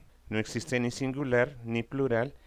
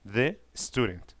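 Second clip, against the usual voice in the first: usual speaking voice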